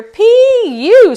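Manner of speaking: very loud, high cub squeal; squealing bear cub. A narrator's reading. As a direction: squeal it out as a disgusted little cub. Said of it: "squeaked the bear cub".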